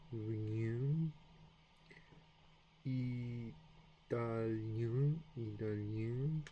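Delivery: bien and rien with nasal ending; French nasal ien. Shown as a demonstration. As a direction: sound out italien slowly with nasal ien. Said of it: "Try these sounds".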